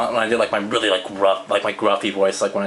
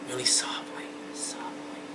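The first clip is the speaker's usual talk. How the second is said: softly whispered, much softer; whispering; 14 dB quieter than usual. Shown as a demonstration. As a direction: breathe the words as a whisper. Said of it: "if I'm talking softly".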